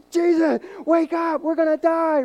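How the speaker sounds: panicked disciples screaming at Jesus to wake up; frantic shout. Retold or imitated. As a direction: shake a sleeping man awake in terror. Disciples shaking sleeping Jesus awake.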